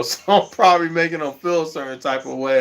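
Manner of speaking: ordinary delivery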